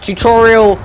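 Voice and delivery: high, loud panicky callouts; excited panic. Real, not acted. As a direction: sound the alarm in a rush.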